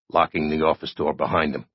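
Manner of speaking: ordinary delivery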